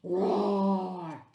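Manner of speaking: loud playful monster roar; mock scary roar. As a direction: roar to scare the kids, but kindly. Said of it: "Let's act a little scary".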